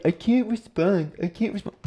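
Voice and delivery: put-on high voice, ridiculing the excuse; mocking imitation. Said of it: "I don't understand people like".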